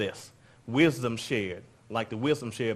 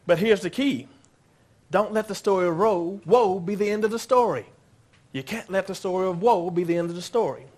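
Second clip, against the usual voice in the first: preaching; 8 semitones higher, 5 dB louder than usual. Real, not acted. Preaching climax, raised voice.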